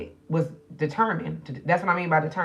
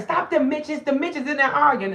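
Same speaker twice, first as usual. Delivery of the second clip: higher, louder scold at the arguing pets; hollering at two bickering animals; mock scolding; 11 semitones higher, 5 dB louder than usual. Mother scolding Rolly and Polly arguing in the morning. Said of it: "I say".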